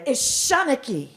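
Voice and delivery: "Is Shanachie" in Irish Gaelic; lilting irish storyteller. Shown as a demonstration. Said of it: "I can speak Irish".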